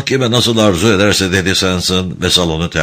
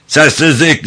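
higher-pitched, restrained shout; bağırış; 7 semitones higher than usual. A narrator's reading. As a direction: call the room to order without full force. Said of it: "diye bağırdı Hackett". (yüksek perdeden, ölçülü bir bağırış)

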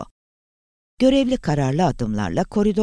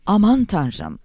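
louder, higher fearful exclamation; full of fear; 7 semitones higher, 5 dB louder, slower than usual. A narrator's reading. Langdon reacts to the message, voice full of fear. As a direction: cry out in alarm. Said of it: "Langdon'ın sesi korku doluydu".

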